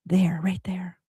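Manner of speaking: hushed, unvoiced whisper. Real, not acted. Pointing out the hidden hurt.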